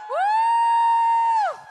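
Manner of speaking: loud high whoop; cheer. Real, not acted. Host whooping for guest getting flowers, loud cheer. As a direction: whoop like a crowd hype man.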